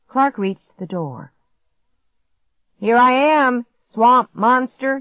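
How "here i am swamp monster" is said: loud, higher, drawn-out call; taunting call. A narrator's reading. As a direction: call out as a cocky dare. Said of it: "he called out".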